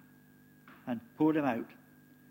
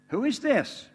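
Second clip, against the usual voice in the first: higher, louder astonished question; fearful amazement; 8 semitones higher, 6 dB louder, slower than usual. Acted. Disciples in the boat asking one another, awed and higher. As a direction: gasp it out in awe. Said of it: "In fear and amazement".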